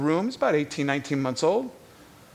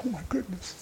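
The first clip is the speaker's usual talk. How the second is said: hushed aside; 3 semitones higher, 11 dB quieter than usual. Retold or imitated. Wife after the door shuts.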